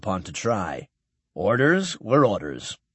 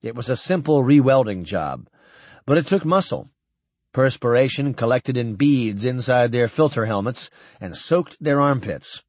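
plain reading voice

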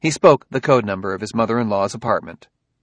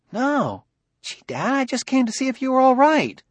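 put-on high, earnest boy's voice; a kid explaining himself to his dad; young son Ben.

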